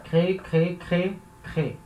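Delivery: "crê" repeated as single syllables; weak syllable dropped. Shown as a demonstration. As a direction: say each "crê" with no added ending. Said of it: "eu pronuncio crê".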